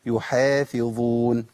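يحافظون very slowly, each letter shaped clearly; يحافظون stretched and exact; correct recitation. Shown as a demonstration. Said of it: "ما قلتش يحافظون".

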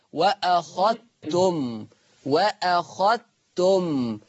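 وأخذتم very slow, dhal merged into the taa. Shown as a demonstration. Teacher demonstrates idgham to students. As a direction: recite وأخذتم as wakhattum slowly. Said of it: "يضغم الذال الساكنة في التاء المتحركة فتقرأ".

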